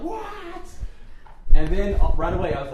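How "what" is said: mock outburst, pitched way up; retold yell. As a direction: imitate a startled yelp. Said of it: "yelled, like".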